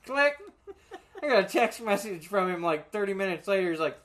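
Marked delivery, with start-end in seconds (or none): 0.1-0.4 mock phone click. Imitating hanging up.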